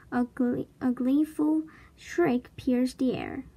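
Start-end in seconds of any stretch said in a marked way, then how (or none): none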